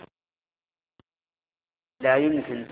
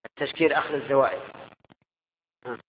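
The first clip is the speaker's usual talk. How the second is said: ordinary talk, nothing marked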